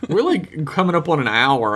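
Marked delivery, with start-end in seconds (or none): none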